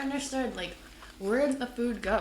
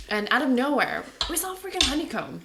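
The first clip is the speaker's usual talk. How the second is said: excited; 5 semitones higher, 5 dB louder than usual. Real, not acted. Excited honeycomb story.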